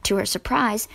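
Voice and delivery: plain reading voice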